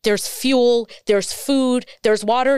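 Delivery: real impassioned challenge to the listener; ranting. Real, not acted. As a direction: keep ranting, punching each item on the list. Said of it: "I'm sorry I'm ranting".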